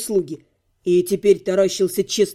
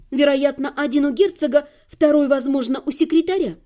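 put-on higher-pitched boy filling in his master's schedule; young servant boy; 5 semitones higher than usual. Servant boy briefing the lady on the duke's study, higher boyish voice. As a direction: voice a lad reporting what he knows.

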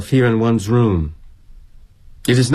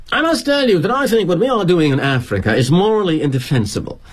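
put-on earnest Brit kid; 7 semitones higher than usual. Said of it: "This Brit kid who works for us".

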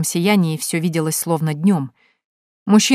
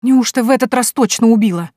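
horrified; 5 semitones higher, 5 dB louder than usual. Acted. Horrified after pushing man.

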